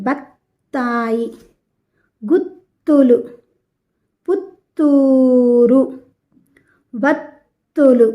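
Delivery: slow, drawn-out words with a long hold on each త్త; బత్తాయి, గుత్తులు, పుత్తూరు, వత్తులు, each split at the doubled త్త; pressed double consonants. Shown as a demonstration. Teacher models for pupils to repeat, pressing each త్త slowly. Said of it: "ఒత్తి పలకాలి".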